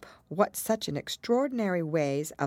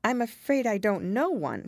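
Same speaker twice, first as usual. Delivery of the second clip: mildly flustered, higher pitch; rather alarmed; 8 semitones higher, 3 dB louder than usual. A narrator's reading. Alice asked for a story, rather alarmed. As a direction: decline with a touch of alarm. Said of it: "rather alarmed at the proposal".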